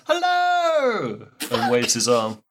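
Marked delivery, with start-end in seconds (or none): 0.1-1.3 cheery shout. Acted. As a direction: call out a big friendly hello. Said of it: "Mort goes".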